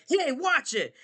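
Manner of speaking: high-pitched indignant warning; police officer. Acted. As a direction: snap at someone who bumped into you. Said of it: "the police officer was like".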